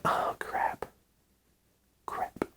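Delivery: under-the-breath dismay, nearly voiceless; hushed defeated oh crap; muttered oh crap. Retold or imitated. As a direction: mutter it to yourself, barely audible. Dad's dismay at crying daughter.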